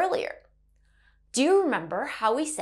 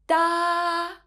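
DA held high and level; first tone; 6 semitones higher than usual. Shown as a demonstration. Teacher showing Mandarin learners the first tone, high and flat. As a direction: say DA on one high, flat, sustained note. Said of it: "is a high flat tone".

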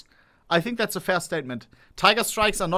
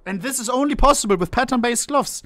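normal talking voice

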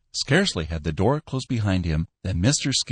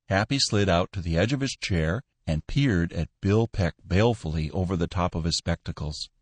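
usual speaking voice

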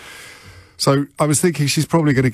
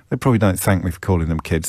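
ordinary talk, nothing marked

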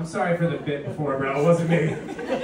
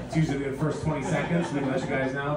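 usual speaking voice

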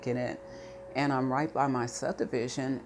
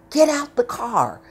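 much higher, much louder shouted command; barking officer; 9 semitones higher, 9 dB louder than usual. Retold or imitated. Policeman yanking her car door open, shouting the order. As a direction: bark it like a cop ordering her out. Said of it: "he's like".

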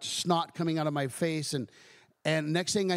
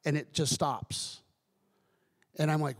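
usual speaking voice

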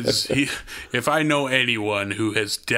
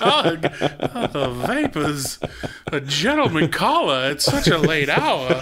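sweet southern shade; 5 semitones higher than usual. Pretend. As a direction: play a fainting Southern belle. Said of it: "my Southern Belle impression".

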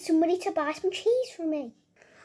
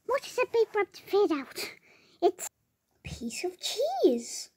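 normal talking voice

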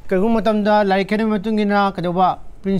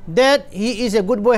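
slow reading; much slower than usual. Shown as a demonstration. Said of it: "ꯍꯥꯏꯕꯁꯤꯗꯁꯨ ꯀꯩ ꯌꯥꯎꯏ".

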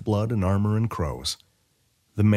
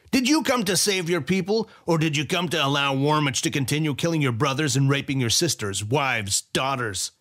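much higher, forceful general challenging; angry general; 7 semitones higher than usual. A narrator's reading. General berating Weyra about Warmich, high forceful voice. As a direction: hurl the questions at him.

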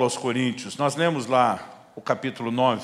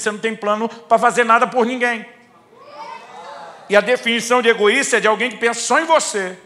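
higher and louder, pressing each point; heated argument; 9 semitones higher, 8 dB louder than usual. Retold or imitated. Pastor retelling his rebuttal to a visitor, heated. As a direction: corner him with his own words.

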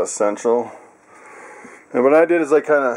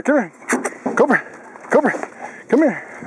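higher, calling out to the dog; 9 semitones higher than usual. Real, not acted. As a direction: call him over and tell him to sit.

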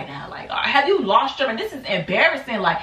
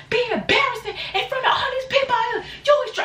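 very high laughing scold; going off; 14 semitones higher than usual. Retold or imitated. Speaker retelling how she went off, high laughing scold. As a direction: scold her while laughing at it.

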